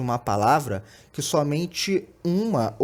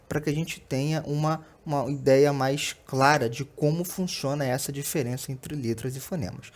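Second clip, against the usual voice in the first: ordinary talk, nothing marked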